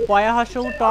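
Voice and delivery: "পয়া হস্য উ ট" letter by letter; spelled out. Shown as a demonstration. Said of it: "বানান করে পড়ি না".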